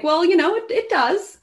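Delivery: high, loud, knowing comeback; high mocking "well, you know, it does"; sassy retort. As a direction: set him straight with a sing-song lilt. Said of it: "I'm like".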